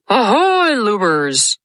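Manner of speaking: lightly voiced pirate captain; Hook voice. A narrator's reading. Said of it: "He imitated the voice of Hook".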